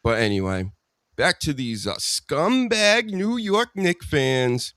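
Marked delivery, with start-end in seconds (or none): 2.0-4.7 scornful jab. Real, not acted. Scornful jab at Knicks fans.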